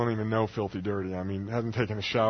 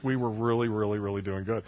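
usual speaking voice

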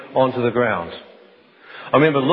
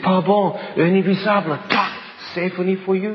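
put-on higher, fervent accented friend voice; Danielle, fervent; 6 semitones higher than usual. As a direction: pour out sympathy in a rush. Danielle reacting to his wound.

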